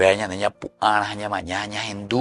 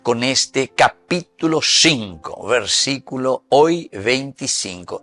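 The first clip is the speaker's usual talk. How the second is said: radio announcer; 2 semitones higher, 3 dB louder than usual. Acted. Radio host returns after break.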